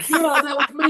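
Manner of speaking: high, proud fan voice; eager fan. Retold or imitated. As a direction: play the fan beaming at being remembered.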